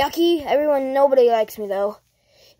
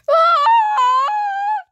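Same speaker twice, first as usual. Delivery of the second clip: high-pitched drawn-out mock scream; cartoon shriek of panic; terrified scream; 4 dB louder than usual. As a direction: scream as if attacked. Scream as he gets attacked.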